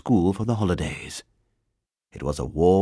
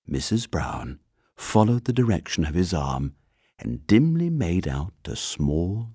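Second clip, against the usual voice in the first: plain reading voice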